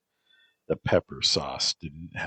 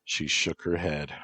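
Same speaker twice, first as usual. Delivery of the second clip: ordinary delivery, nothing marked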